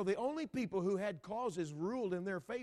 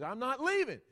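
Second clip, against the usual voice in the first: defiant widow; 9 semitones higher, 8 dB louder than usual. Retold or imitated. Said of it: "And she says".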